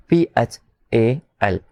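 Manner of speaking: very slow, each letter of पीएचएएल set apart; spelled letter by letter. Shown as a demonstration. A teacher spelling पीएचएएल slowly for the class. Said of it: "इसलिए फल की spelling क्या होगी?".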